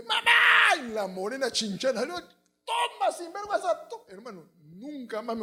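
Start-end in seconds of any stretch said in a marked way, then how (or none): 0.0-0.8 very high, loud cry for help; hammed-up shriek for mom; mock child's scream. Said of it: "grita nomás". 2.0-4.0 high-pitched comic tirade; hammed-up high, furious scolding; mock scolding mother. Acts mother beating bullies with broom.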